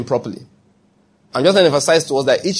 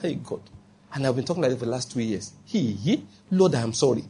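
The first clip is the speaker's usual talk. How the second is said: much softer, a guilty murmured apology to God; hushed repentant prayer; 4 semitones lower, 9 dB quieter than usual. Pretend. Believer suddenly convicted, confessing to God much softer. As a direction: drop right down, sheepish and sorry.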